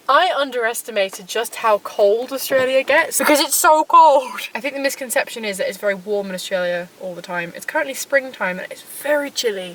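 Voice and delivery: loud and strained against a storm wind; shouting over wind. Real, not acted. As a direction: raise your voice to beat the wind outdoors. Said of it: "You're not gonna hear a word I say".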